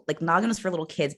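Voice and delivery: ordinary talk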